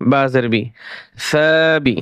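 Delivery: usual speaking voice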